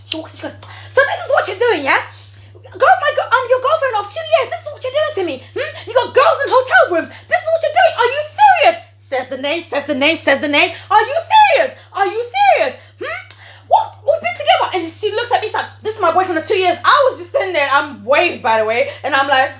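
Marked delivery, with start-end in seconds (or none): 0.9-8.9 very high, loud mock tirade; outraged girlfriend. Acted confrontation by the angry girlfriend. 10.9-14.7 very high, loud mock tirade; outraged girlfriend. Acted confrontation by the angry girlfriend. 15.8-16.8 higher, louder imitation of the girlfriend; indignant claim.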